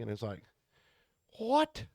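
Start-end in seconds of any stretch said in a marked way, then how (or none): 1.4-1.9 very high-pitched disbelief; incredulous. Retold or imitated. Driver hearing his buddy swaps trailers in a day cab, incredulous. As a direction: squeak it out in mock disbelief.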